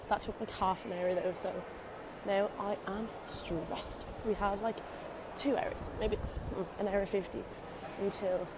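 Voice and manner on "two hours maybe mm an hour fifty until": ordinary talk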